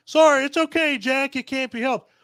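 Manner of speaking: high sarcastic impression of the heroine; mocking Dorothy. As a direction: parrot her in a high mocking lilt. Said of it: "So saying".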